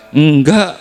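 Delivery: firm denial. Retold or imitated. Jesus telling the mourners no, firmly and loudly. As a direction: cut them off with a firm no. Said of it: "kata Yesus".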